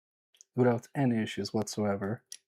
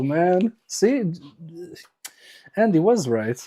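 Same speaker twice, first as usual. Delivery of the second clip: ordinary talk, nothing marked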